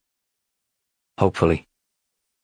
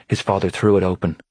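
usual speaking voice

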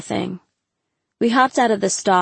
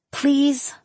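high-pitched pleading; small, imploring question; begging; 7 semitones higher than usual. A narrator's reading. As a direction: plead with a friend to give in. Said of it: "she begged".

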